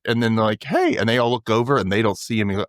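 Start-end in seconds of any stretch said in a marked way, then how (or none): 0.6-1.0 calling out. Retold or imitated. Calling out to ghost.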